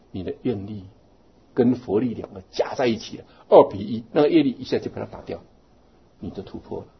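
normal talking voice

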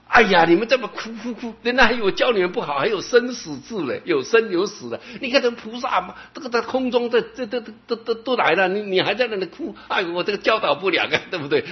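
high, lightly voiced master chiding the weeping disciples; master scolding. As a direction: tease them like a revived teacher. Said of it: "把大家吓一跳". (师父复活后责备哭泣的弟子，半开玩笑)

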